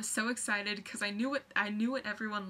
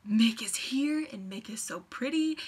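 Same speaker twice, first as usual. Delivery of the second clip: awed whisper; 5 dB louder than usual. Retold or imitated. Awed at seeing Mick.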